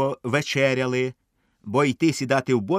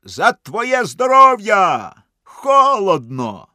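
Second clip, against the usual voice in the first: loud slow call from far off; гукає здалеку; 7 semitones higher, 9 dB louder, much slower than usual. A narrator's reading. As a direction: shout it across the lake, drawn out. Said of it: "чую, гукає". (протяжно гукає здалеку)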